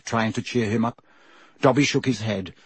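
usual speaking voice

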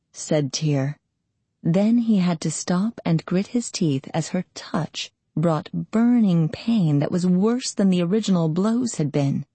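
ordinary delivery

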